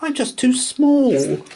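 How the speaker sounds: high, slow, loud and sad; sad mouse. A narrator's reading. Mouse sadly gives up at the hill bottom, slow and high. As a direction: let it sink, slow and sad. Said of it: "sighed sadly".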